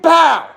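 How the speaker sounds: sharp, high, loud gunshot noise; blurted, high-pitched gunshot sound; mock gunshot. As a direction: bark out the bang of a gun. Imitating a gunshot.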